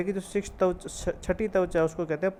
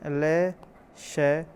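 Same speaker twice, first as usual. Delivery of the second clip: ordinary delivery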